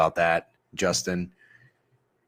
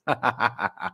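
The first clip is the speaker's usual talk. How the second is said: real laugh; 4 semitones higher than usual. Real, not acted. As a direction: burst of amused laughter. Laughs at viewer's burner joke.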